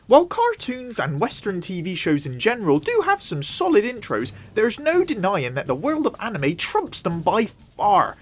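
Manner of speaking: usual speaking voice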